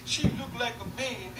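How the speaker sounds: slow, whiny mocking imitation; mock crying rapper. Mother mimicking the rapper insulting her, slow mock whine. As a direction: drag the words like a put-on crybaby. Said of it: "'cause I saw you crying".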